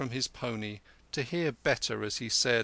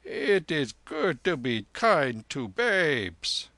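slow, higher, lilting lama; lama explaining; 4 semitones higher, 5 dB louder, slower than usual. Acted. Lama justifying his song to the soldier, slow and lilting. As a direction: draw it out in the lama's lilt.

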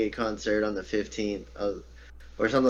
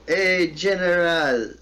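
mock shout across the street; a stranger hailing him; calling out; 7 semitones higher, 5 dB louder, slower than usual. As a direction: call out loud and slow. Shouted greeting in retold story.

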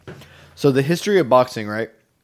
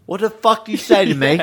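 laughing, high mock British threat; high-pitched mock British yell while laughing; British tough guy; 9 semitones higher, 5 dB louder than usual. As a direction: yell like an angry Brit picking a fight. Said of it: "hear a British or a U K person talk shit".

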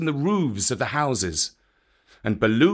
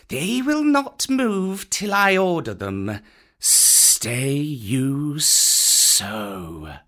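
hissing Kaa; 3 semitones higher, much slower than usual. A narrator's reading. Kaa the python hissing his order to the monkeys. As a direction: stretch it out like a python. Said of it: "Kaa hissed".

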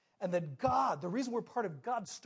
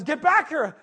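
loud, higher-pitched order; coach's yell; 8 semitones higher, 13 dB louder than usual. Retold or imitated. Coach ordering the crying boy back to bat, yelling. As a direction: call the kid back loudly.

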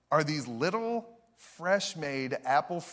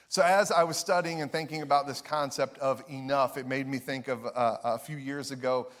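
normal talking voice

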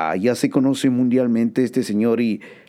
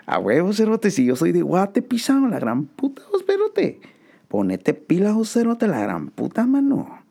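very high, hammed-up slangy voice; cartoonish high Guatemalan voice; exaggerated Guatemalan voice; 11 semitones higher than usual. Continues mock Guatemalan rant.

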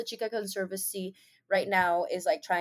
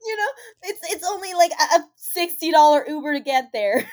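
higher, mock crying excuse voice; mock whiny excuse; 7 semitones higher, 7 dB louder than usual. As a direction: whine it like a lame excuse. Mocking friend's excuse.

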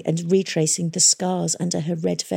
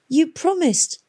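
weakly; 11 semitones higher, slower than usual. A narrator's reading. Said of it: "he said weakly".

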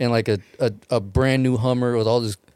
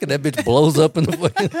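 ordinary talk, nothing marked

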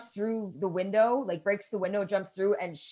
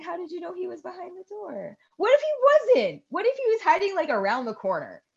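incredulous questioning; 11 semitones higher than usual. Retold or imitated. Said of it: "But I'm like".

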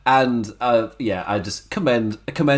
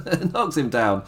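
ordinary talk

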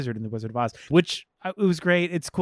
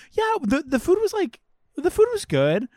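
much higher mock gossip voice; hammed-up catty busybody, pitched way up; gossipy coworker; 13 semitones higher than usual. Mocking the gossip who twists the dinner talk, high catty voice.

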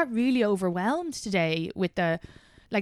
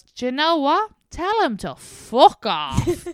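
very slow, higher, deadpan put-down; Irish conductor; 8 semitones higher, 6 dB louder, much slower than usual. Retold or imitated. Train conductor telling her to dump him, slow and dry. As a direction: drag out the line like a fed-up ticket man. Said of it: "The Irish conductor comes back and goes".

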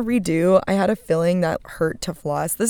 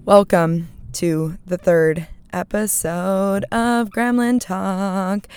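drawn-out welcome; much slower than usual. Real, not acted. Host welcoming listeners to the show, slow and drawn out. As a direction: stretch out every word like a host. Re-recorded podcast intro.

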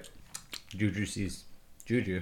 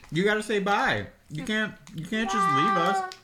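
high coaxing voice; 9 semitones higher, 3 dB louder than usual. Real, not acted. Coaxing Juju to say bye.

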